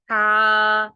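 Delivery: ہا pushed loud and heavy from mid-throat. Shown as a demonstration. Teacher showing learners the heavy ہا, loud. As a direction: breathe ہا out hard from the middle of the throat. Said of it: "ذرا بھاری آواز میں پڑھا جاتا ہے".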